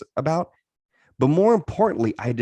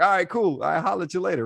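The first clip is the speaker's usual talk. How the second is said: higher-pitched, breezy bro goodbye; casual brush-off; 7 semitones higher than usual. Retold or imitated. Man dodging his friend's "I love you", casual brush-off. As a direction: toss off a light, high-voiced farewell.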